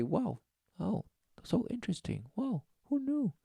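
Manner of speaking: high, soft, fake-amazed gushing; mock wonder. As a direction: gush like a naive reader pretending to be impressed. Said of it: "read it and say".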